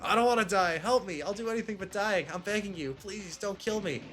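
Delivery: desperate pleading. Acted. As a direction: beg for your life. Acted plea before execution.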